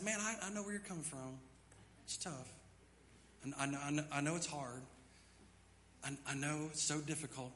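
soft, quiet, falsely soothing; mock sympathy. Pastor mocking coddling, soft soothing voice. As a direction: pat him on the back softly.

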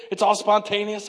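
ordinary talk, nothing marked

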